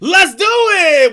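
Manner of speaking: loud, slow cheer; excited shout. Real, not acted. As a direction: shout it like a rally cry. Cheering at the video.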